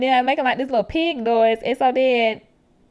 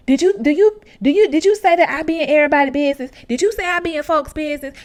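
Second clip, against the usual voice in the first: insistent, rising questions, louder; pressing everyone for an answer; agitated questioning; 5 semitones higher, 5 dB louder than usual. Retold or imitated. True demanding who said he is nosy, agitated.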